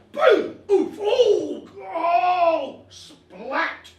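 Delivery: loud high blow-by-blow noises; acted fight impacts. Boxer relives a kid's slam in the gut, loud yelped impacts. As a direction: boom and groan through each hit. Acting out the punch and fall.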